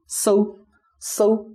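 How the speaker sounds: "so" held high and level; "so" as a high, even Mandarin syllable; demonstrated tone. Shown as a demonstration. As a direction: pitch "so" high and keep it steady. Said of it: "we can make a tone here".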